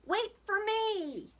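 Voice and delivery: high, drawn-out plea to wait; long high call after departing friends. A narrator's reading. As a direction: stretch it out in a high holler. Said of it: "Sheep called".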